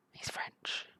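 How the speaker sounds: hushed stage whisper. Acted. Reacting to the prisoner's slip, astonished whisper. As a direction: whisper it as a scandalous discovery.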